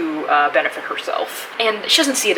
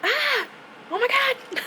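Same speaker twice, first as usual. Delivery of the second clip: high, squealing with joy; giddy relief; 4 dB quieter, much slower than usual. Real, not acted. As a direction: shriek with relieved delight. Hitting the word count goal.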